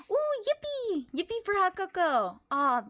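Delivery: ordinary talk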